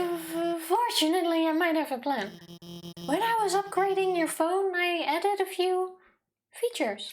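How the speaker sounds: high, stammering and hurried at first, then slowing down; a jittery lab nerd letting slip a secret upgrade; nervous scientist. Acted. Nervous scientist explaining a phone upgrade to the hero, high and stammering. Reading Alphys dialogue aloud.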